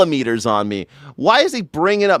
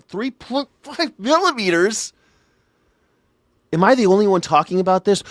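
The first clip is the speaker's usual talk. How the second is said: incredulous; 7 semitones higher than usual. Retold or imitated. Outraged at the metric jack.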